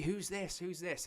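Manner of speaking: high-pitched clueless commentator impression; mock bewilderment. Imitating commentary on a known wrestler, feigned surprise. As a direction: repeat it as if utterly baffled, mockingly. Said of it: "But on commentary".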